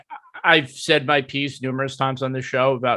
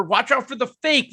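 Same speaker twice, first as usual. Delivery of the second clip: high mock play-by-play warning; PA announcer; 9 semitones higher than usual. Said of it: "you could hear the P A guy be like".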